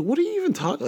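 high, incredulous cry; can't take in the news, high disbelieving outburst. Retold or imitated. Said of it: "I'm like".

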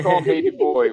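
high, loud teasing coo; high mocking lovey voice. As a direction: coo it like calling a lover. Teasing friend to call lover.

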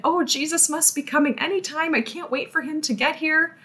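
bubbling over with anticipation, mock excited. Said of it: "shaking with excitement".

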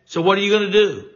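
pointed challenge. Real, not acted. Host challenging GOP leaders on air, fast pointed demand. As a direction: fire the question at them like a challenge.

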